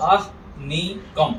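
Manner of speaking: slow आहनीकम, broken as "aah-nee-kam"; ह before न. Shown as a demonstration. Teacher repeats आहनीकम for students, slow demo. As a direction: draw out आहनीकम with the ह ahead of नी. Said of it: "ह पहले, फिर न है".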